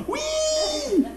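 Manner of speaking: high, laughing squeal of glee; mock joyful whee. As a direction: whoop it up high like a thrill ride. Horse rubbing him against trees.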